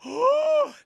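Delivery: huge high, loud gasp; mock shocked gasp. Streamer reacting to the killer's confession, hammed-up gasp. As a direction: suck in air in exaggerated shock.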